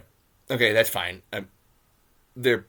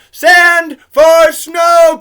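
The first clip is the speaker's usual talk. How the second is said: shout; 17 dB louder, much slower than usual. Real, not acted. Same words said twice.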